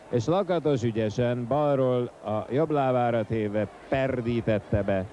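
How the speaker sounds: ordinary talk, nothing marked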